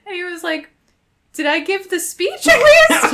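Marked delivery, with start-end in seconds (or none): 1.3-3.1 high, loud, laughing question; hammed-up hopeful plea. Embarrassed proposer asking about his speech, hammed-up high plea. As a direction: ask it high, hoping against hope.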